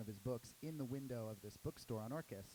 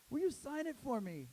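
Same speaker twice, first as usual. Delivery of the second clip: high, loud eager request; eager fan; 14 semitones higher, 9 dB louder than usual. Retold or imitated. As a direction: beg for an autograph. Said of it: "the guy goes".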